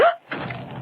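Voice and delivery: a quick unvoiced gasp of fright; startled gasp in the dark; sharp frightened gasp. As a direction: suck in air as something brushes your face. Something touches her face in the dark.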